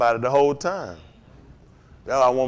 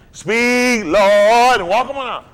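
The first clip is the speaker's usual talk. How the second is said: preaching call; 6 semitones higher, 7 dB louder, much slower than usual. Acted. Preacher mocking church invitation.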